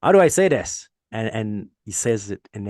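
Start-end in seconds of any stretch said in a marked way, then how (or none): 0.0-0.9 put-on high, loud little-kid question; son's voice, raised and puzzled; imitating his young son. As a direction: mimic a puzzled first grader asking for help. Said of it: "So he's like".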